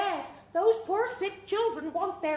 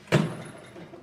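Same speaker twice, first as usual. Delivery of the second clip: sharp breathy gasp of wonder; a wide-eyed gasp on entering; gasp of awe. Acted. Guest gasps at spooky house.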